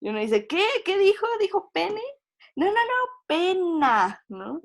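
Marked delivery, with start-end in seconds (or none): 0.5-2.0 high, loud stunned "what did she say?"; shocked disbelief. Retold or imitated. Listener shocked by the slip. 2.6-4.2 high, hurried embarrassed denial; flustered correction. Retold or imitated. Speaker hastily corrects the slip.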